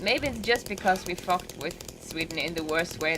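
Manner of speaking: ordinary delivery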